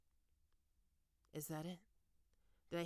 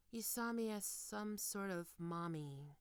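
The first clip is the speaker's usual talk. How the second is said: usual speaking voice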